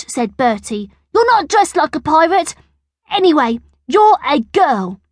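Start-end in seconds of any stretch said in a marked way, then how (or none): 1.1-5.0 high, loud schoolboy squabble; acted childish contradiction, pitched high; bossy retort. A narrator's reading. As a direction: shut the other child down. Bertie argues Angela can't play.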